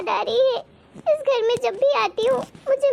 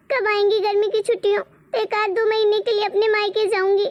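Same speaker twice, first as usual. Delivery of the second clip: ordinary delivery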